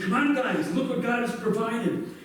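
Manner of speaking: rally them, mildly excited; eager Jonathan. Retold or imitated. Jonathan urges tired soldiers.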